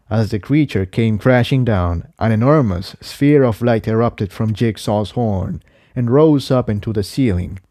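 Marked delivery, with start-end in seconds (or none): none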